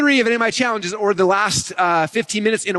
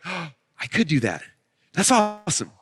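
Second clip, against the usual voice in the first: amazed realization; slower than usual. Retold or imitated. Audience amazed by the demo.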